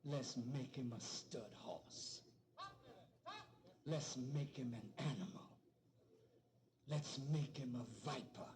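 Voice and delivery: low, cold, deliberate slave master; a hint of a slave master. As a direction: voice the scheming master lightly. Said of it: "the slave master said".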